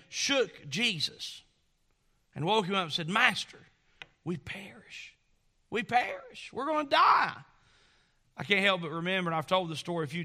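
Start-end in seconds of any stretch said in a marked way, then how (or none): 3.1-7.3 frightened disciples. Acted. Disciples waking Jesus in fear.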